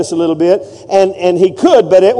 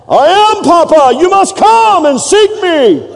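mock biblical prophet; 11 semitones higher, slower than usual. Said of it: "They all".

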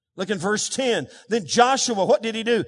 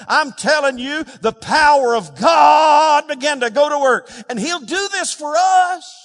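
building to a high, loud shout; impassioned preacher's crescendo; fervent preaching; 4 semitones higher, 5 dB louder than usual. Real, not acted. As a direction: raise the pulpit cry as the miracle comes. Preaches at full force.

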